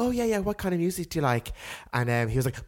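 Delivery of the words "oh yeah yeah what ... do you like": higher, bouncy mimic of the date; imitating eager date. Mimicking the date's eager question, higher and bouncy. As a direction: chirp it back too eagerly. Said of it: "And he was like".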